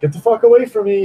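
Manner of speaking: hammed-up angry brush-off; mock yell. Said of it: "then I'm like".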